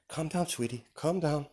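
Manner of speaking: high, soft sing-song soothing; soothing lullaby voice. Retold or imitated. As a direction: hush a fussing baby gently. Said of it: "She's singing to him".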